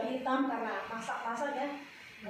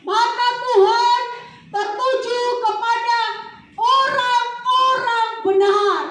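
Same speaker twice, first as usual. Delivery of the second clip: loud, slow, high proclamation; proclaimed verse; 10 semitones higher, 11 dB louder, slower than usual. Acted. Preacher booming the verse back to the congregation. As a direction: thunder the verse out slowly. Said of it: "preacher proclaims the verse".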